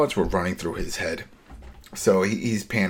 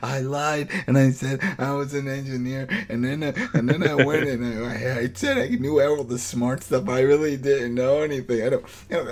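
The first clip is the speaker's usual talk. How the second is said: lightly voiced higher, rambling Eugene with a laugh; half-hearted mock of a nervous confession, higher; 5 semitones higher than usual. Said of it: "tell the whole story of how he met".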